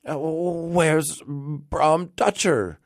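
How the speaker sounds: high, slow, faltering question; hesitant inquiry. A narrator's reading. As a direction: draw out the question uncertainly. Rip asks after another friend.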